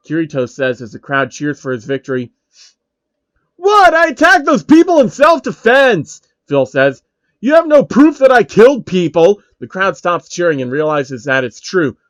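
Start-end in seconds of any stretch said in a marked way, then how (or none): 3.5-9.4 loud, high defensive protest; outraged denial; indignant. Acted. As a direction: protest his innocence. Phil defends himself.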